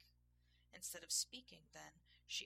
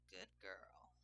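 low, softened purr; deep, quiet character purr; 10 semitones lower, 6 dB quieter than usual. A narrator's reading. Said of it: "he purred".

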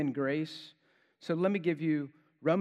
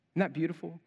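usual speaking voice

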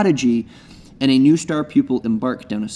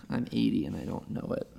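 ordinary talk

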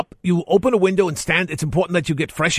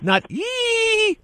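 sudden shrieking high ee, much higher; shrill ee. Shown as a demonstration. Host shows the caller the wrong squirrel noise, shrill. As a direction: squeal a thin high ee like a squirrel. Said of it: "try and lower it".

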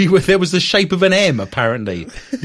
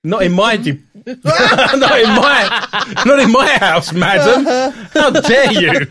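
high, loud hammed-up outrage, laughing through it; mock-scandalized and laughing; mock indignation; 7 semitones higher, 4 dB louder, slower than usual. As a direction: play the offended lady, laughing. Mock indignation at the host's remark.

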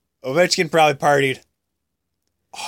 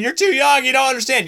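mock nagging; 11 semitones higher than usual. Mocking what everyone says.

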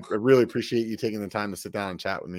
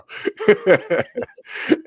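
breathy laugh; 10 semitones higher, 7 dB louder than usual. Real, not acted. Joke about no YouTube money.

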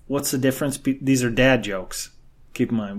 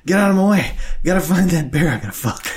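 wild punchline yell; 7 semitones higher, 6 dB louder than usual. Retold or imitated. Ragged Texan returns, punchline.

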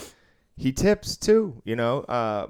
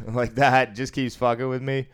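ordinary talk

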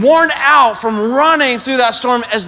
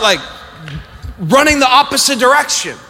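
normal talking voice

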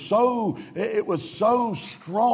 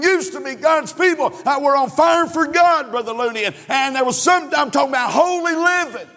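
higher and louder, shouting the build; fiery preaching; 6 semitones higher, 3 dB louder than usual. Acted. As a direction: preach it out over the congregation. Preaching at full force.

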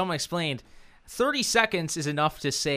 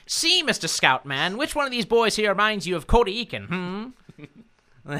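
evil billionaire voice; 6 semitones higher, 5 dB louder than usual. Pretend. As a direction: lord it over the scout like a villainous billionaire. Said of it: "he does his evil billionaire voice".

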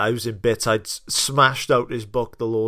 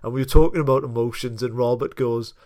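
ordinary delivery

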